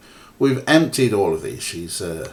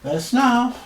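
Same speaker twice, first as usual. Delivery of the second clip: very high sing-song pet voice; pet-talk squeak; 13 semitones higher than usual. Real, not acted. Showing viewers the cat, high pet voice.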